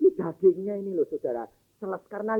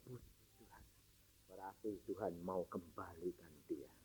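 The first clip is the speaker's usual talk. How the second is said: whispered, much softer praise; relieved whisper; 13 semitones lower, 26 dB quieter than usual. Retold or imitated. Father whispers thanks beside his sleeping daughter, hushed. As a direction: breathe your thanks so as not to wake her.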